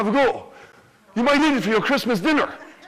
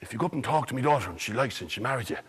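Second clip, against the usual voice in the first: lower, softer king, quick in the middle; 8 semitones lower, 8 dB quieter, faster than usual. Acted. King bargaining with Jack in a low voice. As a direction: murmur the offer low like a canny king. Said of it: "what the king said".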